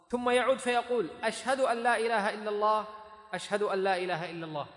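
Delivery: loud, high recitation of a narration; reading out text. A narrator's reading. Teacher reads Abu Mahdhura's hadith to students, loud formal reading.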